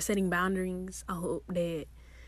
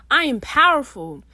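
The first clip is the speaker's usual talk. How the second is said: loud affirmation; 6 semitones higher, 11 dB louder than usual. Acted. Affirmation announced loudly.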